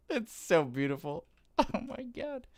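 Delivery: high, laughing awe; overwhelmed gushing. Real, not acted. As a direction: gush with a laugh, pitch climbing high. Overwhelmed by the game's beauty.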